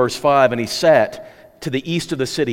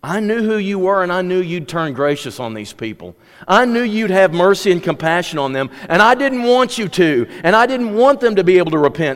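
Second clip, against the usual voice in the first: rising, louder bitter complaint to God; resentful Jonah; 7 semitones higher, 5 dB louder than usual. Acted. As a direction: Jonah pushes his grievance harder and harder.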